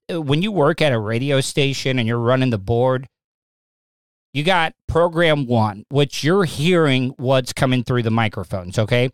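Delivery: ordinary delivery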